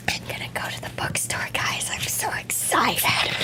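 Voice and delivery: soft secretive whisper. Acted. Whispered aside to viewers.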